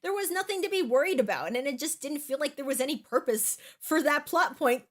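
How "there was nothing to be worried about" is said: ordinary delivery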